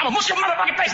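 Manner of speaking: high shouted threat; high mock yell. Convict threatening a kid, high mock shout. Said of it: "so he's like".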